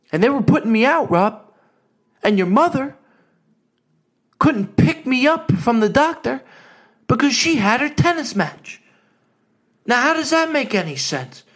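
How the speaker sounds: mock-outraged old father; dad's indignant complaint. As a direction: complain about being abandoned at the doctor. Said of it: "My dad even told me".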